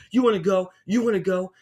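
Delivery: imitating a woman spoiling for a fight; aggressive challenge. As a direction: pick a fight with anyone near. Lady picking fights in restaurant.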